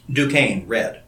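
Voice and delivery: ordinary delivery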